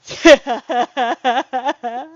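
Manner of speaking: loud, bubbly run of giggles; helpless chuckling; real laugh. Real, not acted. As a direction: laugh at your own silly pun. Laughs at the pun.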